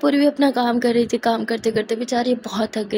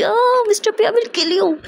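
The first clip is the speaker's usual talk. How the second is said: much higher, playful jealous threat; mock threat; 10 semitones higher, slower than usual. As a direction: threaten him in teasing exasperation.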